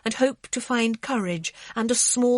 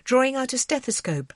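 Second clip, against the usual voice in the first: ordinary delivery, nothing marked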